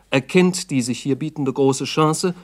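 normal talking voice

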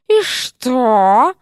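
sharp, biting snake retort; venomous. A narrator's reading. Серпентина answers the flustered dean, venomous. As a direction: spit the question like venom. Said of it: "звучало так язвительно".

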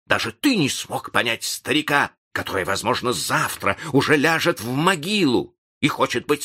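wounded reproach with high peaks; protest, lifting the voice on key words. A narrator's reading. Seventy-year-old reproaches the young man, indignant and hurt. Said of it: "повышая голос".

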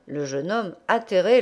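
usual speaking voice

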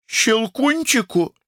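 высокое медленное возмущение; переспроси, возмутившись; возмущённое удивление. A narrator's reading. Сестра возмущена. (возмущённый переспрос)

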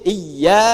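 "iya" with a single light y; without tasydid. Shown as a demonstration. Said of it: "Kalau tanpa tasydid". ("iya" tanpa tasydid, ya ringan)

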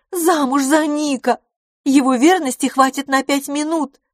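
loud, high-pitched outburst; crying out. A narrator's reading. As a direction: exclaim it incredulously at full voice. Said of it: "вскричала мисс Лафос". (громко, высоко, вскричав)

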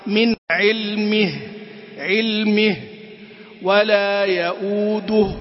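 slow "علمه" with a clear -mih; careful penultimate vowel. Shown as a demonstration. Teacher modelling recitation, careful vowel before the stop. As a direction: end "يؤوده" on a full rounded -duh. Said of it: "نعتني بحركة الحرف قبل الأخير".

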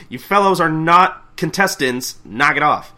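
loud, high, drawn-out scolding; angry announcer. Acted. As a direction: tell off the intruders with force. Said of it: "the announcer's getting angry".

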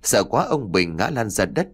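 plain reading voice

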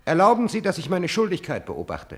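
laut und hoch, übereifrig; dränge dich höflich laut auf; eifrig laut. Acted. Höfling drängt sich der Gräfin auf. (übereifrig laut und hoch)

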